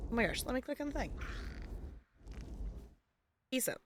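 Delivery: ordinary talk, nothing marked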